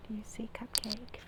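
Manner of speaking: soft whisper to a hiding dog; hushed coaxing whisper. Real, not acted. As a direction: breathe it so the dog stays calm. Quietly coaxing the shy dog.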